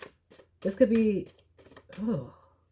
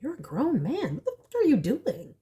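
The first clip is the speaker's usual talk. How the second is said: incredulous retort; 6 semitones higher than usual. Retold or imitated. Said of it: "I'm just like".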